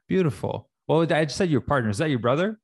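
ordinary talk, nothing marked

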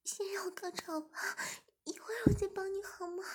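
bargain, then beg; high pleading woman's voice. A narrator's reading. Zhimin pleading.

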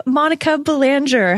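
usual speaking voice

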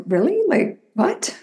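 incredulous question, pitch swinging up at the end; mildly acted bafflement; retold disbelief. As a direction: react as if you can't believe it. Retells own disbelief at doubters.

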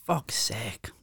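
whispered under the breath, fed up; exasperated whisper. Real, not acted. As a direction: hiss it to yourself in disgust. Muttered exasperation at the taste.

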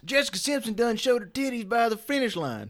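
put-on high-pitched Southern hollering accent; thick Southern accent. Joining in as drunk race fan, thick Southern accent. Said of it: "my Southern accent gets a little thicker".

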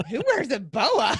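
laughing through the question; giggling in disbelief at a boa. Real, not acted. Friend laughing while asking who wears a boa.

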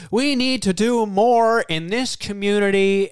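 mock speechifying. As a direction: stretch it out like an empty speech. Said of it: "He's like".

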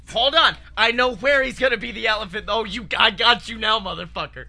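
loud, high, eager; excited realization. Real, not acted. Pitching the movie, he gets excited at the idea. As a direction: blurt it out as it clicks.

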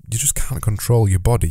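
usual speaking voice

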